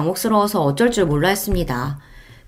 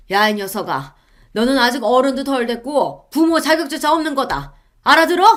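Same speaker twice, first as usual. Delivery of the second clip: higher scolding father, loudest at the end; father yelling; 6 semitones higher than usual. Acted. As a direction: berate your grown daughter. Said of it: "엄청난 고함". (딸을 호통치는 아버지)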